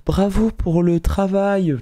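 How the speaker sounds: high, drawn-out mock praise; mock polite congratulations. Streamer parodying stock compliments to an artist, mock polite. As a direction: imitate formulaic, over-polite congratulations. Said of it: "j'ai pas commencé à faire les trucs de".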